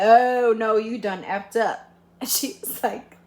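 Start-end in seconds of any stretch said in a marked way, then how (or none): 0.0-1.8 loud drawn-out oh then a scolding no. Retold or imitated. Sister scolding the date on the steps, loud telling-off. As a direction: tell him off loudly.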